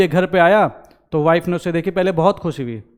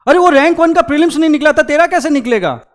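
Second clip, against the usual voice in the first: high, fast, taunting put-on voice; mocking naysayer; 9 semitones higher, 5 dB louder, faster than usual. A naysayer taunts the aspirant, high fast mocking voice. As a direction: sneer it up high and quick like a know-it-all.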